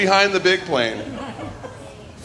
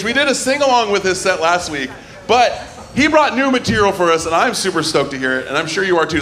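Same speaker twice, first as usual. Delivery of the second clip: normal talking voice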